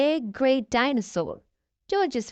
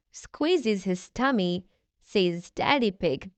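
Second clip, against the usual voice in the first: ordinary delivery, nothing marked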